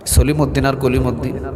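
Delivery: normal talking voice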